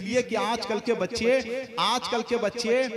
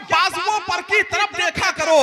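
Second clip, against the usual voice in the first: higher, rising declamation with nahin lifted; mushaira recital; 5 semitones higher than usual. Acted. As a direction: repeat the verse building toward the crowd. Addresses the chair, recites again.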